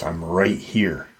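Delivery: low, soft, understated creature reply; lightly voiced creature. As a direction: answer quietly and low as the unseen creature. Voicing the creature's reply in darkness.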